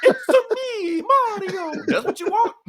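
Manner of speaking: high, laughing fake Italian Mario voice. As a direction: ham up the Mario catchphrase with a laugh. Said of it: "What the fuck does Mario sound like?".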